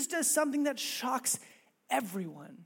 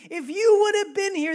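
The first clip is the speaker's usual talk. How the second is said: grieving reproach; 9 semitones higher, 9 dB louder than usual. Retold or imitated. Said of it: "says the exact same thing".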